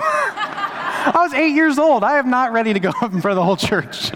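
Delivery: laughing through the words at his own memory; high, amused laughing retelling. Real, not acted. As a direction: chuckle while you admit it. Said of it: "But that's funny".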